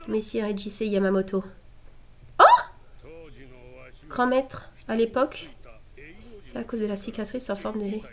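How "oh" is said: very high startled oh; sudden gasp. Real, not acted. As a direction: jolt up in surprise. Sudden shock at the scene.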